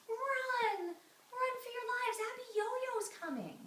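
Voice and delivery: high, drawn-out cry of alarm; panicked warning. Acted. Townspeople warning that the giant comes, acted panic. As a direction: call out the alarm to the whole town.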